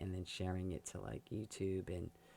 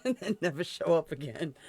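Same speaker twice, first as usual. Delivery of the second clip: laughing punchline; 7 semitones higher, 10 dB louder than usual. Retold or imitated.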